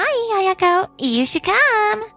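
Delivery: high, drawn-out, sugary taunt; singsong mock friendliness; gloating invitation. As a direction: rub it in sweetly to the wife. Mistress gloatingly invites the wife.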